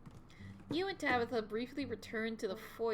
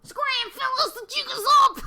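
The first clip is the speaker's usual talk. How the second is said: much higher, loud frantic critter cry; panicked bug voice; 16 dB louder than usual. Acted. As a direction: shriek like a bug caught out. Bugs fleeing the toilet.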